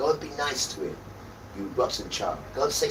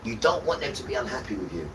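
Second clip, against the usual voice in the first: ordinary talk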